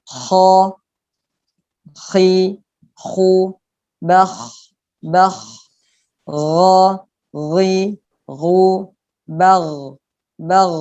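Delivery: kha, khi, khu with a raspy back-of-mouth kh, gha, ghi, ghu voiced and heavy; thick kha and gha. Shown as a demonstration. Teacher demonstrating Arabic letters for students, heavy throaty kha and gha. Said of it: "dibacanya menjadi tebal".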